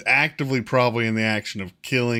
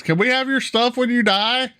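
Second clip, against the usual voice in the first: yelling NPC; 12 semitones higher than usual. Acted. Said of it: "one of them's yells".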